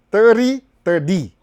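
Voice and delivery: "Thirty" blurred, then split slowly as ther-DEE; T as D contrast. Shown as a demonstration. Teaching American T, "thirty" ending in a clear D. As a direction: say "thirty" twice, loud and slow, landing on D. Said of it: "American T yao ina pronounce kama D".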